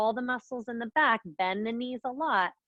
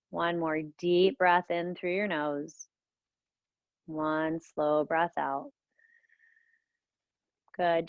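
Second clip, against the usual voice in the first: guided meditation; 2 semitones lower than usual. Acted. Leads a guided breathing meditation.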